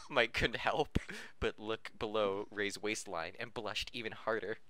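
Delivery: usual speaking voice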